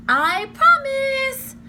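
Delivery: high very slow sing-song; sing-song promise. Acted. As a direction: stretch it out playfully. Annie, exaggerated.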